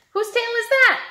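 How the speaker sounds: high, sing-song quiz question; quizzing voice. Acted. Teacher quizzing children, high and playful. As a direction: pitch it way up to tease the kids.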